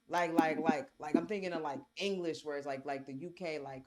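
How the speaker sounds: usual speaking voice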